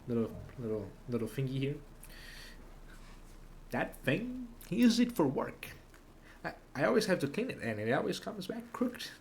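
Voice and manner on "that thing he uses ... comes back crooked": high put-on woman's voice; voicing the woman. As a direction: play her as a complaining wife. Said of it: "I don't wanna voice her".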